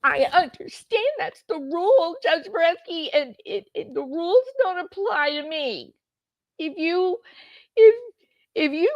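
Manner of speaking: high, pouty imitation whine; mock whining. Mocking Lori objecting to the judge's rule, whiny and hammed-up. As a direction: stammer into a put-on tearful protest. Host mimics Lori protesting the rules.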